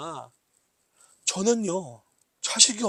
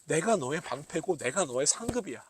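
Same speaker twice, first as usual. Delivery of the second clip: gentle reassurance; 4 semitones lower, 8 dB quieter than usual. Acted. God's answer, quietly voiced.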